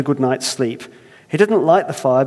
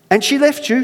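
higher, louder Irish disbelief; incredulous Irish; 12 semitones higher, 4 dB louder than usual. Acted. Earthmaker astonished, Irish accent. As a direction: repeat it in astonishment. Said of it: "I can't help but try it".

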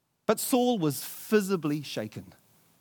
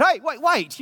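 calling out; 9 semitones higher, 6 dB louder than usual. Acted. King calling David back.

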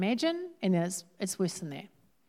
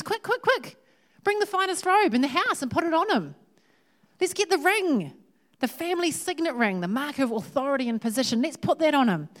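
high-pitched, hurried joyful orders; rally the servants with rising excitement; acted fatherly urgency, pitched high; excited, urgent father; 7 semitones higher, 3 dB louder than usual.

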